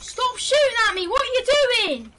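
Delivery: high, loud angry yell; raging. Real, not acted. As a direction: yell it in outraged protest. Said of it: "No need to be a big rager".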